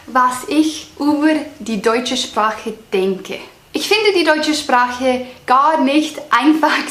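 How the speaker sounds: slow, deliberate German, each word placed with effort; careful slow German. Shown as a demonstration. For her viewers, she slowly speaks German as a learner. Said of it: "I'm gonna say this part in German for you guys".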